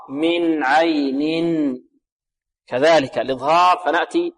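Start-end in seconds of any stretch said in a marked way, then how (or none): 0.1-1.8 very slow من عين, each n distinctly sounded; clear noon. Shown as a demonstration. Teacher demonstrates the rule, clear noon. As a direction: stretch من عين, letting both n's ring clearly.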